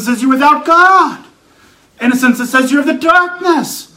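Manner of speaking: emphatic, repetitive pulpit cadence; forceful preaching. Acted. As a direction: hammer each charge, lifting the final word.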